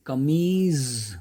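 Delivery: कमीज drawn out slowly to a buzzing Z; dotted Z sound. Shown as a demonstration. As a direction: stretch कमीज loudly, "kameeez". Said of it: "उसकी जो pronunciation है".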